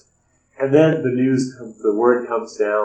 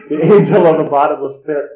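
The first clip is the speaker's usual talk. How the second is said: higher, louder mock-grand declaration; loud proclamation; 5 semitones higher, 8 dB louder than usual. Madman in the hospital names himself, loud grand proclamation. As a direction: proclaim it like a doom-laden title.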